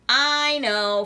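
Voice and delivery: loud, eager call; Froggy cries out before Rabbit speaks; cried out. A narrator's reading. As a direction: blurt it out. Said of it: "Froggy cried".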